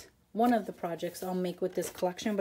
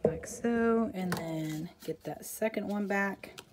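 ordinary delivery

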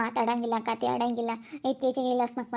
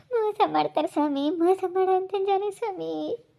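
weep through the words, begging for justice; crying; 3 semitones higher than usual. Real, not acted. Mother crying to the police officer about her son, sobbing. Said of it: "ಅಳ್ಬೇಡಮ್ಮ, ಅಳ್ಬೇಡಮ್ಮ".

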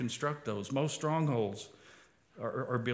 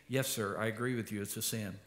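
softer, meek agreeing voice, fading away; humble confession; 5 semitones lower, 6 dB quieter than usual. Acted. Believer confessing sin to God, soft and humble. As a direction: own up gently like a contrite servant.

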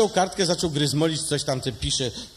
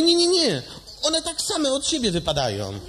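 eager patient; 11 semitones higher than usual. Acted. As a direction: blurt out the denial eagerly. (gorliwy pacjent, wysoko)

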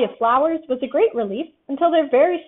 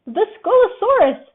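high frightened squeak, louder; 8 semitones higher, 5 dB louder than usual. A narrator's reading. Piglet hearing growls in the cave, terrified squeak. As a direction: squeak it out in terror. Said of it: "squeaked Piglet".

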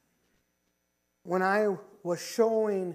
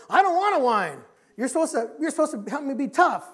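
loud, higher-pitched retold objection; acted protest; 5 semitones higher, 7 dB louder than usual. Pastor voicing dying David refusing to whine, acted protest. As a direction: re-enact a friend protesting forcefully.

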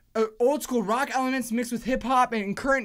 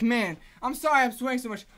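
ordinary delivery

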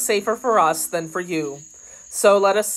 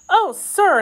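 high, louder, eager cry; grateful exclamation; 13 semitones higher, 6 dB louder than usual. A narrator's reading. Kitty offered a place away from Paris, exclaiming. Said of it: "exclaimed Kitty".

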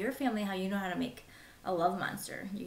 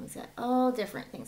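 ordinary delivery, nothing marked

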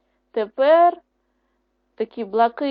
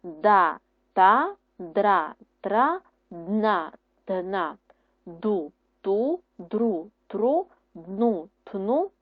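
each syllable read out separately and crisply; syllable drill; much slower than usual. Shown as a demonstration. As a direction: model the syllables for the class to repeat. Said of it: "Читаємо ось так, стовпчиками". (читання складів по одному)

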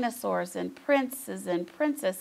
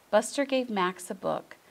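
usual speaking voice

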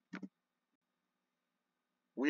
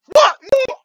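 very high, loud cry of disbelief; shocked yell; 14 dB louder than usual. Real, not acted. Gamer seeing a chance missed, shocked yell. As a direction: yelp it in sudden dismay.

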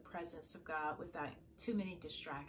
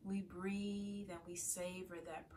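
calm, softer meditation voice with long pauses; guided meditation; 7 dB quieter than usual. Acted. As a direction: guide listeners gently into prayerful stillness, leaving space to breathe. Leads a morning meditation.

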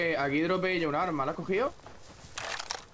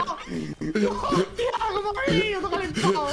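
surprised shout; 13 semitones higher, 3 dB louder, slower than usual. Real, not acted. Surprised by rushing enemy.

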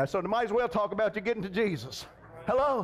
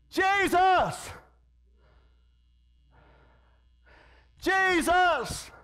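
high, ringing call for help; crying out; 7 semitones higher, much slower than usual. Acted. Blind man calling to Jesus for change, crying out. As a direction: call out the name as a desperate plea. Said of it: "He cried out to Jesus".

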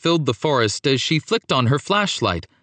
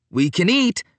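upbeat, higher-pitched announcement; announcing; 7 semitones higher than usual. A narrator's reading. Paolo answering his friends at breakfast, upbeat announcement. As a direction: announce it cheerily. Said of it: "Paolo announced".

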